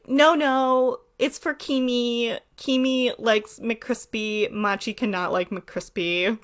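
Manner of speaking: slow, lightly mocking character voice; drawn-out, self-important third-person protest; Kimi. Kimi making fun of Machi's order, slow and haughty. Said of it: "she would be like".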